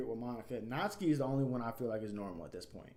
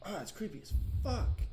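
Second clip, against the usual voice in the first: creeped out; 10 semitones higher, 4 dB louder than usual. Acted. Reacting to Yuri's creepy scene.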